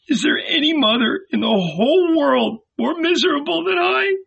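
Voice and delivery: very high, anguished lament; high tearful keening; heart-rending cry. A narrator's reading. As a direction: wail it in a mother's despair. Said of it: "heard the heart-rending cry".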